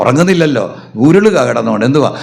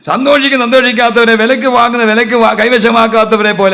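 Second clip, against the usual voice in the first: high, loud fervent sermon; fervent pulpit preaching; 11 semitones higher, 4 dB louder than usual. Real, not acted. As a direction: preach at full force. Full-force preaching.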